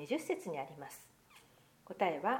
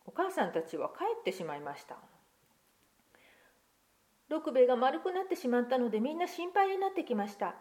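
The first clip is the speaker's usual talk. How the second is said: put-on lively picture-book reading to children; story narration; 4 semitones higher than usual. Pastor reading a picture book to children, lively storytelling. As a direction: read the tale aloud to the congregation, expressive.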